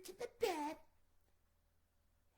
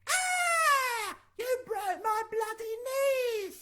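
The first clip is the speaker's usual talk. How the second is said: much higher, much louder crying scream; pained scream; 7 semitones higher, 13 dB louder, much slower than usual. Acted. Goldilocks screams at the bear who broke her knees. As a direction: shriek in pain over broken knees. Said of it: "said little ... Goldilocks".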